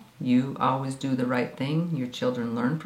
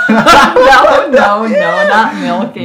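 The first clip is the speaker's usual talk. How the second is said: laughing denial; 7 semitones higher, 13 dB louder, much slower than usual. Real, not acted. Mother laughing, denying.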